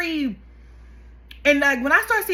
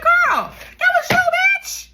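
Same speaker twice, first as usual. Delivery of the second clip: much higher, louder angry yell; mad outburst; 7 dB louder than usual. Real, not acted. Yelling at the mother on the documentary, furious shout. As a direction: yell at her through the screen. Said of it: "I'm sorry, I got mad".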